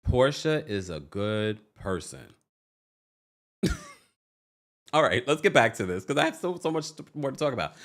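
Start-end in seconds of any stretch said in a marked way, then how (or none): 0.0-2.3 low, slow, sarcastic affirmation; mock conviction. Shown as a demonstration. Vlogger repeating a line to make herself believe, mock sincere. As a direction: say it like a mantra you don't believe. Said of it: "we'll believe it".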